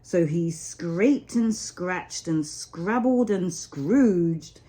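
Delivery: ordinary delivery, nothing marked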